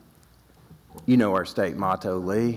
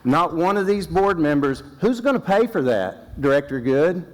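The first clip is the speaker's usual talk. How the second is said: much higher and louder, demanding an answer; heated challenge; 9 semitones higher, 3 dB louder than usual. Real, not acted. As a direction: confront the director head-on. Angry challenge to council directors.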